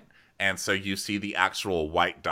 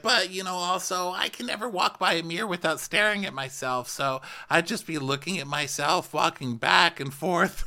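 mock her dreamy self-absorption, pitched way up; imitating Denise; 9 semitones higher than usual. Host mocking Denise's mirror habit, high mock voice. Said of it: "And she's like".

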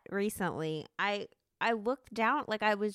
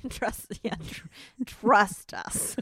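laughing; 4 dB louder, slower than usual. Real, not acted. Promising a treat.

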